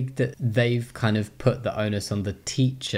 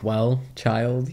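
lightly voiced solemn parent; a hint of a weighty parental address; mock-serious parent about to explain; newspaper-reading parent; 3 semitones higher than usual. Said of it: "I'd put my glasses on".

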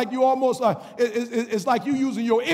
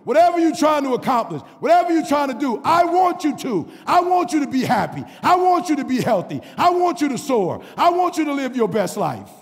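louder, higher preacher shout; full-force preaching; 6 semitones higher, 5 dB louder than usual. Acted. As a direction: call out the church on their excuses.

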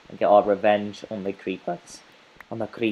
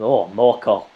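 louder, delighted cry; cheering at finding more coal; excited cheer; 9 dB louder than usual. Real, not acted. Gamer finds more coal, cheers louder.

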